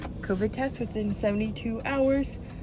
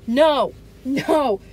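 ordinary talk

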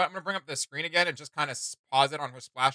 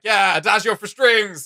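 excited recognition; 6 semitones higher, 8 dB louder, slower than usual. Retold or imitated. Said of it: "And I'm like".

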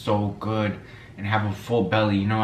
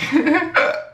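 high awkward laugh; 3 dB louder than usual. Real, not acted. Laughs at dead bird.

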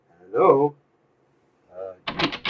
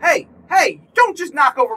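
indignant call; 9 semitones higher, 4 dB louder than usual. Real, not acted. Scolding a character knocking lamps.

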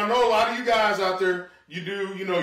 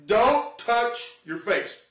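don't touch your face drawn out slowly, don't stressed; slow stern warning; slow emphatic warning; 3 semitones higher, much slower than usual. Shown as a demonstration. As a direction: spell it out slowly like a stern safety notice. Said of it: "whatever you do".

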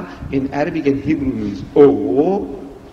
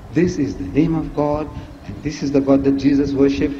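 ordinary talk, nothing marked

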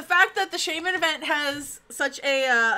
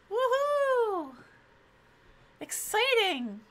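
sarcastic high cheer, slow; 10 semitones higher, much slower than usual. Pretend. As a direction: fake enthusiasm, dragged out. Watching a system update.

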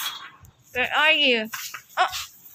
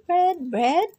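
usual speaking voice

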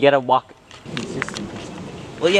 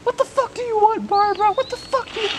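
much higher put-on cartoon voice. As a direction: snap it in a squeaky cartoon voice. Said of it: "he's like".

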